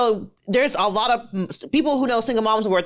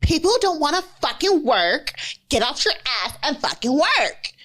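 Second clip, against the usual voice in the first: higher-pitched mock scolding quote; mocking, higher-voiced work lecture; quoting Kim Kardashian; 5 semitones higher than usual. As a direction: imitate a celebrity lecturing people about work. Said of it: "and she was like".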